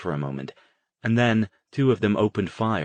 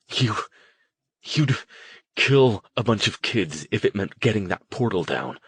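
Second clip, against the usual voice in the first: shaking with disbelief; 2 semitones higher than usual. Acted. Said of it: "His voice shook with disbelief".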